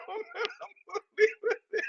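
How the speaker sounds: high, squeaky laugh-talk; words lost in real laughter; helpless laughter. Real, not acted. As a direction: try to sign off while cracking up. Host cracking up, cannot go on.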